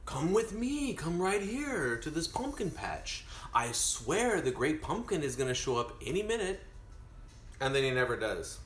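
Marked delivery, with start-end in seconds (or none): 0.1-6.6 high, imploring cartoon kid's pitch; eager coaxing Linus. Acting Linus coaxing Sally to wait.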